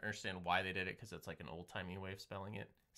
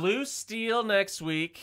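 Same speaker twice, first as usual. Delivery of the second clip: dramatic announcer voice; 9 semitones higher, 9 dB louder, much slower than usual. Pretend.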